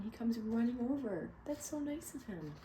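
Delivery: ordinary delivery, nothing marked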